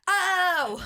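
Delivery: high hammed-up groan; mock cry. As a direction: play the dragged fan's whimpering cry. Imitating the fan's cry.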